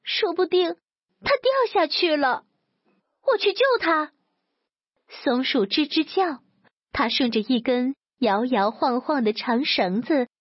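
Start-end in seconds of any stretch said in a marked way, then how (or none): 0.0-4.1 high-pitched, distressed cat crying out; tearful cry. A narrator's reading. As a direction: call out in alarm, pitched well up. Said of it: "猫哭着大喊".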